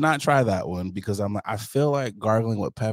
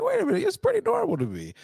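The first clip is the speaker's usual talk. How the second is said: high voice; faster than usual. Retold or imitated. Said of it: "I was just like".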